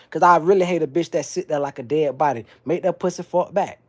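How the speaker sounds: usual speaking voice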